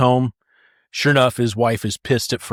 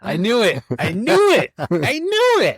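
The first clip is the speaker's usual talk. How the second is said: climbing higher and slower each time; gleeful I knew it; 11 semitones higher, slower than usual. Real, not acted. Catching the joke's twist early, gloating sing-song. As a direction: crow it, gloating more with each repeat. Teasing triumph, rising pitch.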